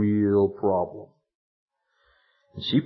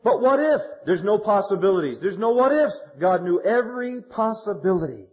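forceful preaching; 8 semitones higher than usual. Acted. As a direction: hammer it home from the pulpit.

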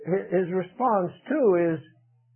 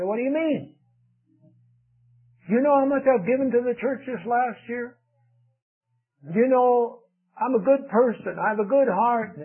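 mock indignant protest; 6 semitones higher than usual. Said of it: "They get angry".

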